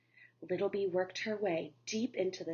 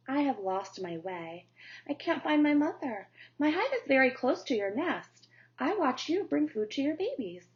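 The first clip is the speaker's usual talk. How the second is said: lightly raised, pleading little bee voice; a small lost bee asking for help, a bit higher; 4 semitones higher than usual. Acted.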